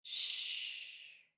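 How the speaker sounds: soft, drawn-out whispered shh; long, quiet shushing sound. A narrator's reading. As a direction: hush them in a whisper. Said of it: "Merrick whispered".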